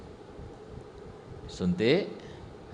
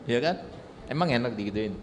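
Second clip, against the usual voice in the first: normal talking voice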